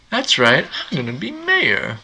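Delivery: high, proud mayor boast; Goldie Wilson voice. Retold or imitated. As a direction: announce it like a proud candidate.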